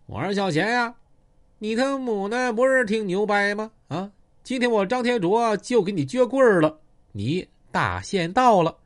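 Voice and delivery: high, loud gang-boss taunt across the yard; shouting threats at the rival from thirty metres off; yelling a challenge. Acted. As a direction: call him out loud and high, cocky. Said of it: "就开始开喊了".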